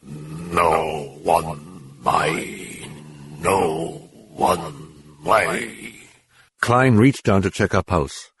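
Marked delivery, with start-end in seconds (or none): 0.0-5.9 very slow, low, soft demonic mutter; slow guttural demon voice. Acted. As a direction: murmur it from deep in the throat. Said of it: "mutter something ... oddly guttural tone".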